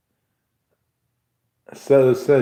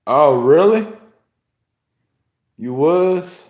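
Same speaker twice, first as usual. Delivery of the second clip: high, louder oh really; incredulous; 5 semitones higher, 5 dB louder than usual. Real, not acted. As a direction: sound openly disbelieving. Reacting with disbelief.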